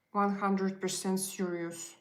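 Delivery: normal talking voice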